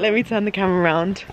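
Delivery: ordinary talk, nothing marked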